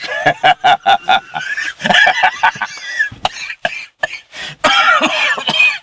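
drawn-out mocking cackle, climbing high; long high gloating cackle. Blackmailer gloating over his alibi with a long high cackle. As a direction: cackle at him, long and pleased with yourself.

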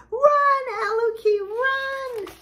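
very high, urgent storybook cheer; urgent cry. Acted. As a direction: urge her to flee in a high voice. Urging the fleeing girl.

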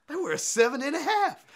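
sudden high, loud outburst of realization; excited realization. Retold or imitated. Skater realizing his shoes are too big, excited shout. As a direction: blurt it out, suddenly getting it. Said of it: "And I'm like".

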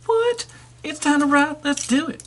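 very high squeal of excitement; squeaky excited toy. Pretend. The second toy answers the ride invitation, very high excited squeak. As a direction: squeal it like an overjoyed little robot.

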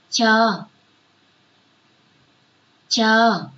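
a clear, stressed Chờ for pupils to copy; model sound. Shown as a demonstration. Teacher modelling the sound Chờ for pupils. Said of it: "Chúng mình cùng phát âm nhé".

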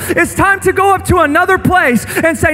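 impassioned sermon over music; full-force preaching. Acted. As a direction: build the altar call with fire.

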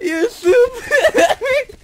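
high, slow words through laughter; cracking up while talking; helpless laughter. Real, not acted. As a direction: laugh too hard to finish the insult. Cracking up at teammate.

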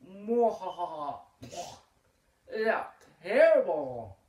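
high loud villain cackle; evil laugh. Acted. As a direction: cackle with wicked glee. Villain gloats.

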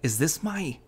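high-pitched incredulous question; indignant, pitched well up; disgusted. A narrator's reading. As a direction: ask in appalled disbelief. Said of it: "with disgusted expression".

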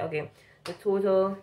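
ordinary talk

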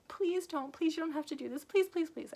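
high pleading voice, imitating a frightened victim; begging. Tied-up woman begging intruders to stop, pleading. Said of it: "She was begging".

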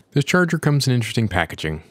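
usual speaking voice

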